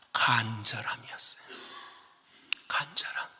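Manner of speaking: soft breathy whisper. Acted. Pastor whispering the point to the congregation. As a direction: whisper the key word to them.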